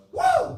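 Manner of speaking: loud, high whooping cheer; crowd whoop. Retold or imitated. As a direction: whoop like a rowdy audience member. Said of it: "someone goes".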